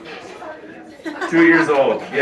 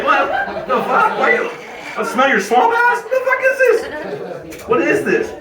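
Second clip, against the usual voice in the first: high, squealing disgusted protest; mock indignation climbing into a squeal; comic outrage; 10 semitones higher than usual. Husband reacting to his wife's smelly prank, comic outrage.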